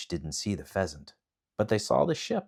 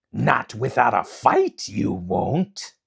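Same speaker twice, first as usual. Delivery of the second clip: 1 demon king; 3 semitones higher, 7 dB louder, slower than usual. Acted.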